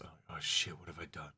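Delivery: put-on low, soft grumbling old man; Old Man Hurston voice. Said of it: "Old Man Hurston voice".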